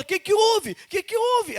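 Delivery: high, worried repeated question; alarmed question. Retold or imitated. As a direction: voice the startled wife. Worried wife asking what happened.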